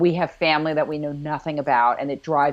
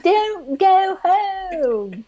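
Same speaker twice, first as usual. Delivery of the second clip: much higher, very slow mock child whine, laughing; whiny child plea; much slower than usual. A boy begging Mac not to leave, in a hammed-up child whine. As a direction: stretch it out like a pleading little boy. Hamming up the boy's plea again.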